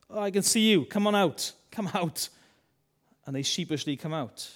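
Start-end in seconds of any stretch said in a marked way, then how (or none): 0.1-2.3 higher, louder playful call. Retold or imitated. God finding them in the bushes, loud call. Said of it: "what would you say if they".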